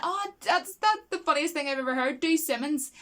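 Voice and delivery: mock thrilled, egging the room on; delighted [name]. [name] loving the room's laughter, gleefully excited.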